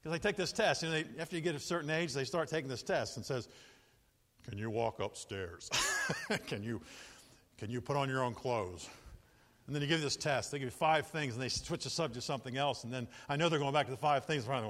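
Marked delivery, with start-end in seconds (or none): 4.5-8.9 doctor quizzing. Retold or imitated. Doctor's memory test questions.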